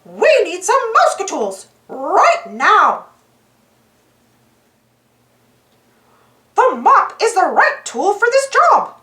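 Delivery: high cartoon-mouse voice, cheerful and sure; little hero picking the right tool; Mickey Mouse voice. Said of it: "said Mickey".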